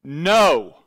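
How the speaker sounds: loud, sudden commanding shout. Retold or imitated. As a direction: bark it out like a voice from above. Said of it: "a voice shouted at me and went".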